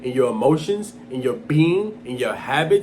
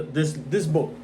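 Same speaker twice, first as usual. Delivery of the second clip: usual speaking voice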